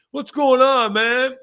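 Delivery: put-on high, loud, cheery drunk greeting; drunk Australian dude. Drunk Australian greeting the bathroom gang, high loud cheery call. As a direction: hail them like a merry drunk tourist. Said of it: "this Australian dude".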